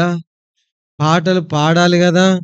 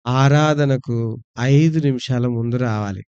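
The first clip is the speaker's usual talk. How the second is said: usual speaking voice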